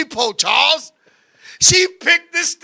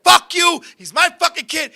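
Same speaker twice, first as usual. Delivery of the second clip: higher-pitched mock angry mother; retold angry retort; 6 semitones higher than usual. Mother arguing with the store guy over her son, mock angry. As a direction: imitate a mother firing back indignantly.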